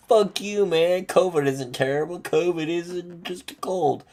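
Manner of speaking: high mock yell; mock angry friend. Friends scoffing at covid worries, high mock yell. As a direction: play the scoffing buddy dismissing covid. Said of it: "And they're like".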